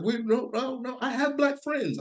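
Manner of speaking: high mock voice of nervous partygoers. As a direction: squeak out an eager denial, mocking them. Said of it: "They're just like".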